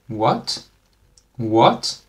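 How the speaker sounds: "What" swept upward like a puzzled question; questioning rise. Shown as a demonstration. Teacher likens second tone to English question, "What" rising. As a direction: raise the tone sharply through "What". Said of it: "sounds like you're asking a question".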